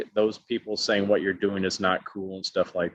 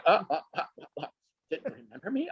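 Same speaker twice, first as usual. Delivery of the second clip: mock stammering shock; 7 semitones higher, 5 dB quieter, much faster than usual. Cheerleader stunned at being forgotten.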